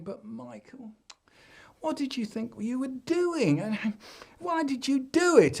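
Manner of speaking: high, puzzled mother; mum voice. Acted. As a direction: ask like a bewildered mum. Mum questioning.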